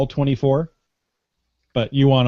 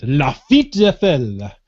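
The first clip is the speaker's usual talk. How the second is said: slow, louder put-on French accent; 5 dB louder, slower than usual. Shown as a demonstration. Host redoes the winner's name for fun, French accent. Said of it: "say that with a French accent".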